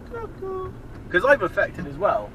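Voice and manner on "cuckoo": high, softer cuckoo repeat; faint high cuckoo; softer cuckoo echo. Shown as a demonstration.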